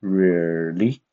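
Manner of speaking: really dipping then rising; really said with a V-shaped tune; falling and rising tone. Shown as a demonstration. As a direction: draw really down and back up. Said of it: "going down and up".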